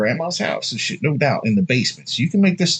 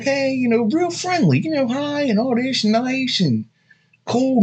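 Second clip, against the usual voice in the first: friendly jokey greeting; 11 semitones higher than usual. Retold or imitated. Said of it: "I used to be like".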